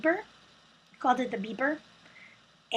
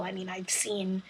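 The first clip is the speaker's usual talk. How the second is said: whisper; 3 semitones lower, 6 dB quieter than usual. Real, not acted. Aside while correcting herself.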